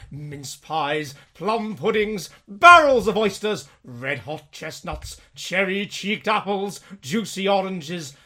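slow, dramatic read-aloud; story narration. A narrator's reading. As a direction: read it like a Christmas tale. Reads Dickens aloud dramatically.

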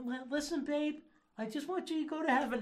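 high, hurried mock pleading; placating boyfriend. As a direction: parody a meek boyfriend soothing his girlfriend. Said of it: "You don't need to".

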